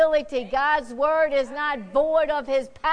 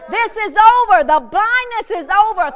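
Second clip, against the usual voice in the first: excited shout; 6 semitones higher, 7 dB louder than usual. Acted. Bartimaeus exults, voiced loudly.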